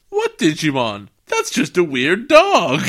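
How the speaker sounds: loud, drawn-out incredulous retort; hammed-up oblivious grandpa; mock dismissal. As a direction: scoff slowly and loudly at the idea. Grandpa dismisses giant Digimon.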